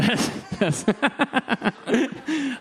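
amused laughing fit; real laugh. Real, not acted. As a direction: laugh as the joke lands. Laughs after his joke lands.

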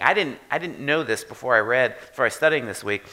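ordinary delivery

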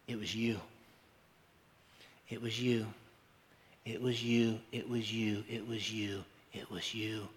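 hushed refrain dying away; fading refrain. Real, not acted. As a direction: start gently and fade to a whisper. Repeated refrain to congregation, fading away.

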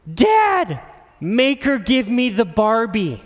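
put-on high, loud pleading child; whiny little girl. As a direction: beg Dad like a whiny kid. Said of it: "They'd be like".

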